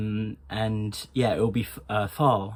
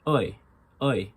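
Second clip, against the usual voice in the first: Irish "oi", between I and OY; Irish I sound. Shown as a demonstration. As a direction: round "oi" slightly, Irish style. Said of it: "People with an Irish dialect would say".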